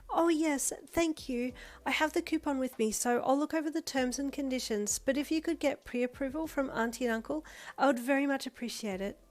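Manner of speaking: a courteous classmate, bright and keen; polite Momo. Acted.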